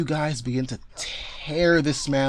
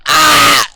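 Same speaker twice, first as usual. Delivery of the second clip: sudden shrill scream, much higher and louder; 13 semitones higher, 15 dB louder than usual. Acted. Game master voicing Amelia screaming for help, shrill scream. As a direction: scream out in alarm. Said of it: "you hear Amelia scream out".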